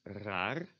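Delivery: "raar" with a rolled first R; Dutch raar. Shown as a demonstration. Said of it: "a word that illustrates this".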